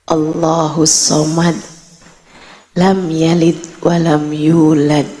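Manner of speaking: low, measured Arabic recitation; Quran recitation. Retold or imitated. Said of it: "Bacaannya begini". (membaca ayat Al-Qur'an dengan khidmat)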